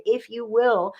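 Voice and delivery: ordinary talk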